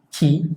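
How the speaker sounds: "qui" said as a crisp K with the U silent; French pronunciation. Shown as a demonstration. Teacher showing the silent U after Q, crisp kee. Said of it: "aquí pronunciamos".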